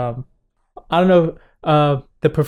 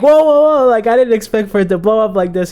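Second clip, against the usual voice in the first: alarmed backpedal; 5 semitones higher, 4 dB louder than usual. Retold or imitated. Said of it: "I was like".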